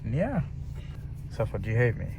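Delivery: ordinary delivery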